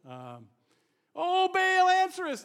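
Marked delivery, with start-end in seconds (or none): 1.2-2.4 high, loud desperate cry to a god; shouting to Baal. Retold or imitated. Prophets crying out to Baal, loud and desperate. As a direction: shout it up to the heavens. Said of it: "they shouted".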